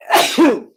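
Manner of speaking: sudden loud sneeze; real sneeze. Real, not acted. Host interrupts his show, sneezing loudly. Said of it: "God bless myself".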